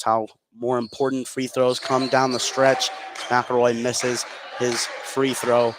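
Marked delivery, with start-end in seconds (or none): none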